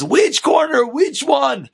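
high-pitched acted questioning; mock-exasperated son; exasperated asking. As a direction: call back, confused and impatient. Son calling back, confused.

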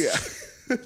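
high squealing laugh; real laughter. Real, not acted. Friend cracking up at the McDonald's memory, laughing high. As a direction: laugh it out, far up.

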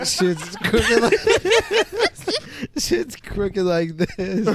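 laughing. Retold or imitated. Kid looking in mirror laughing.

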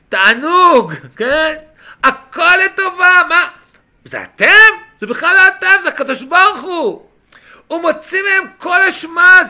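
loud, high, emphatic proclamation; impassioned preaching. Acted. Preacher voicing Joseph clearing his brothers of blame, impassioned. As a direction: preach it out with punched words.

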